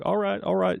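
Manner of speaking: lightly voiced English guy; a rough try at a British accent. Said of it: "I can't do accents".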